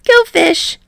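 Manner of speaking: high gleeful taunt. Acted. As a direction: crow it with glee. Card game taunt.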